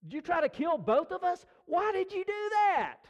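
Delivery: high, loud yell at the instructor; rising panic, shout him down; retold yelling. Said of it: "he started yelling at the flight instructor".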